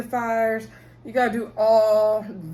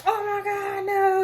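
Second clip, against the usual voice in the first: very high, mock-panicked squeal; hammed-up shocked scream of denial; horrified shriek; 11 semitones higher, slower than usual. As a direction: squeal it as if caught red-handed. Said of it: "And then she's like".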